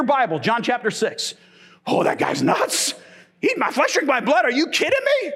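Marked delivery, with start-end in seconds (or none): 1.9-5.3 huffy, incredulous scoff rising high; scoffing disbelief. Retold or imitated. The crowd mocking Jesus's words, incredulous scoff. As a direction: scoff like they can't believe what he said. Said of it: "crowd reaction, whole crowd left".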